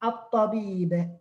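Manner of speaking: slow At-tabib, no l, doubled t; assimilated lam. Shown as a demonstration. Teacher repeating the correct word for students, assimilated lam. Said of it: "you will say at-ta".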